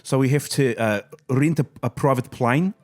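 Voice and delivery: New Zealand accent, lifted and loud. Shown as a demonstration. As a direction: say it like a Kiwi bloke. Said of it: "some of the phrases I can do, like, in New Zealand".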